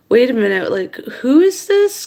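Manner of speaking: re-enacted suspicion, pitch leaping up; high, incredulous retold challenge; suspicious questioning. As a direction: challenge a dubious caller, voice climbing high. Retells challenging the suspicious caller.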